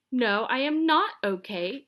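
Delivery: put-on loud, slow, cross old lady; loud slow angry no; angry shout. A narrator's reading. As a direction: snap back furiously. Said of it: "shouted Missus Mintry angrily".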